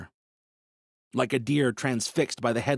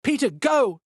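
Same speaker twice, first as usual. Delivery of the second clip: high urgent shout; yelling; 13 semitones higher, 4 dB louder than usual. A narrator's reading. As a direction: yell the order. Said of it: "Viola yelled".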